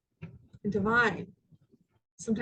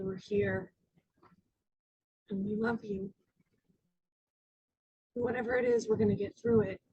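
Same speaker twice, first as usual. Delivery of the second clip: tender reassurance; 4 dB quieter than usual. Retold or imitated. Parents on the couch comforting their daughter, soft and tender. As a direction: reassure gently, leaving space between phrases.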